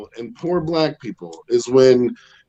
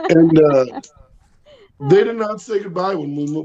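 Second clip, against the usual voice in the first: ordinary talk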